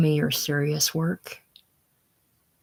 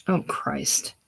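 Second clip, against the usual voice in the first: ordinary talk, nothing marked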